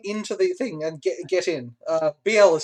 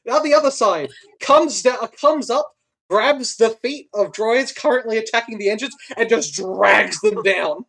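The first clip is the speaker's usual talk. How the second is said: raised, energetic action narration; dramatic game master; 4 semitones higher, 5 dB louder than usual. Acted. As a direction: build the action with punched, louder words. Game master narrates droid's attack.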